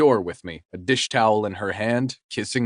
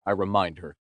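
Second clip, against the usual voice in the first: plain reading voice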